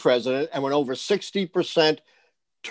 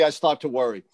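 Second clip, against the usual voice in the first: usual speaking voice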